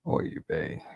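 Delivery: exasperated breathless whisper; voiceless sigh of despair; whispered oy vey. Real, not acted. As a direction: let it out as a weary whisper. Exasperated aside after mocking quote.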